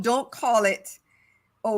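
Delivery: ordinary talk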